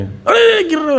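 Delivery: high, jolting alarm shout; sudden shout. Shown as a demonstration. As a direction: yell it to jolt someone away from a pit. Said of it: "ऐसे झटका देके बोलोगे".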